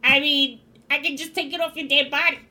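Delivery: usual speaking voice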